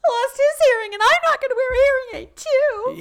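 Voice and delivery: mock a melodramatic teen; whiny teen parody. Mocking Tootie's whiny lines.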